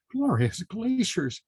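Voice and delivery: high, slow piping voice; piped. A narrator's reading. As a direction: pipe it out like a small marmot. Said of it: "piped Mandy Marmot".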